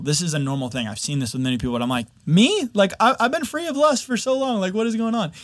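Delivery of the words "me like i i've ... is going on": high-pitched, disbelieving self-quote; incredulous protest. Retold or imitated. Man recalls asking why this is happening to him, incredulous. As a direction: retell your own bewilderment in a much higher voice. Retelling his disbelief at own affliction.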